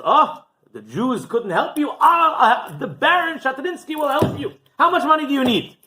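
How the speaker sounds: high, loud, expansive benefactor; booming, self-important nobleman; grand Baron. Acted. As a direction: boast with a big flourish of generosity. Baron grandly offers his help.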